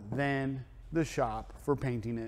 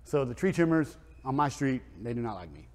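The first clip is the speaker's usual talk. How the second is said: ordinary delivery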